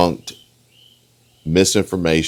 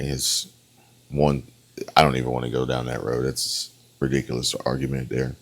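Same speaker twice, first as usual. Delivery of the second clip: ordinary delivery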